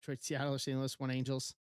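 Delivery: low, lightly put-on East Coast accent, imitating a buddy; a hint of Tom's East Coast accent, dropped low. Mimicking Tom's trade wishes in a low East Coast-ish accent. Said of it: "Yelling Tom is saying".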